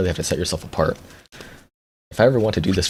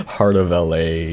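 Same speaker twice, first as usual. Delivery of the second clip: usual speaking voice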